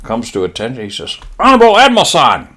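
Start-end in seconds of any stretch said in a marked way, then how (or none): 1.4-2.5 high, loud, fast mock-Japanese address; Japanese lieutenant. Lieutenant addressing his admiral, high mock-Japanese shout. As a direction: snap it out like a junior officer. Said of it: "Lieutenant stood up and he goes".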